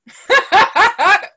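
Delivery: a high, loud real laugh breaking out; loud burst of laughter. Real, not acted. Cracking up while telling a friend the story, loud real laugh. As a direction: crack up hard.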